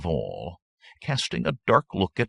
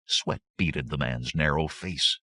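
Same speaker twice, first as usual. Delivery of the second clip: plain reading voice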